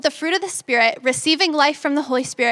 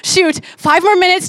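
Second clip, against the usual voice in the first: higher, louder, strained muttering at the wait; losing patience, words spilling out faster; irritated self-talk; 5 semitones higher, 4 dB louder than usual. Retold or imitated. To herself while her dad blocks the coffee, irritated and strained. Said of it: "automatically I'm irritated".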